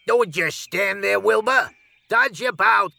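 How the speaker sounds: high commanding goose calls; bossy goose. A narrator's reading. Goose ordering Wilbur to flee, high and commanding. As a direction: urge the pig on like a bossy goose. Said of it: "cried the goose".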